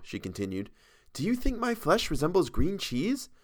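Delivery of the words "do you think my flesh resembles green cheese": put-on higher young woman, teasing. A narrator's reading. Model teases the painter, higher voice.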